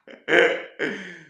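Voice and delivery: loud, high burst of laughing; real laughter. Real, not acted. Joker after his West Country line, loud laugh. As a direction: crack up at your own joke.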